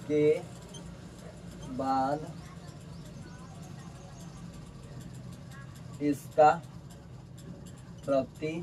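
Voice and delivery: word-by-word dictation with long pauses; drawn-out words, widely spaced; slow dictation. Real, not acted. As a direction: dictate slowly, as if writing each word out. Dictates while writing.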